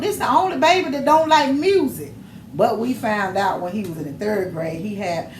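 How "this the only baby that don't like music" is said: high, loud retold complaint; exasperated bafflement. Grandmother complaining about the baby who cries at music, exasperated. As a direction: ask it in raised, baffled disbelief.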